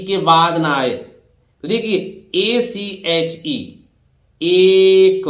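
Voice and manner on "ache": एक drawn out loudly, ch as k; silent-h pronunciation. Shown as a demonstration. Teacher modelling "ache" for students, एक with k sound. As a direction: stretch एक into a long, loud "ay-k". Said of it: "तो एच यहां silent है".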